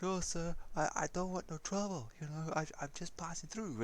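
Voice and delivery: a mild, meek plea to be left alone; pleading driver. Retold or imitated. Driver begging the costumed men to let him pass, meek.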